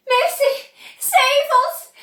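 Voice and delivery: high, loud desperate yell. A narrator's reading. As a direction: cry out for rescue in panic. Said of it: "yelled Missus Beauregard".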